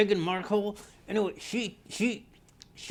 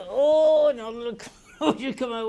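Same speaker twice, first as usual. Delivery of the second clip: much higher, louder shriek on "Oh", laughing through the rest; mock startled shriek; 8 semitones higher, 8 dB louder than usual. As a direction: play the neighbor jumping at the bugs. Neighbor startled by cockroaches in mailbox.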